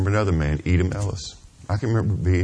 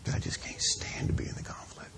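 choked whisper; 12 dB quieter than usual. Real, not acted. Emotional confession, voice fading.

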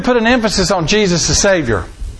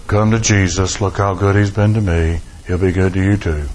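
much lower, mocking a pious sales pitch; mock preachy believer; 10 semitones lower than usual. Pastor mocking how Christians pitch Jesus, much lower voice. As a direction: mimic a smug churchgoer preaching at someone.

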